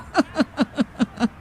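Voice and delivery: a soft, falling villain's chuckle; evil chuckle. Acted. Uncle plotting a fight, with a soft villain's chuckle. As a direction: laugh quietly at your own plan.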